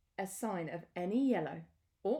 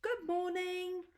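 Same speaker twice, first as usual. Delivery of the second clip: much higher, friendly elephant voice; Elmer's reply; 11 semitones higher than usual. Acted. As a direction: answer sweetly in a high voice. Elmer answers the animals.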